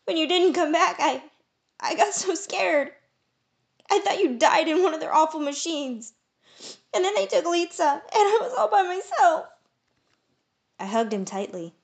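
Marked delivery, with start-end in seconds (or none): 0.1-9.5 much higher, louder tearful rush; sobbing. A narrator's reading. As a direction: break down in tears.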